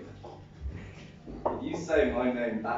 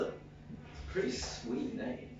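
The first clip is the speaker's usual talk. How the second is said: normal talking voice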